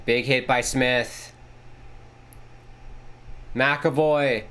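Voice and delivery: steady broadcast call of the action; play-by-play. Acted. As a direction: call the game like a TV announcer.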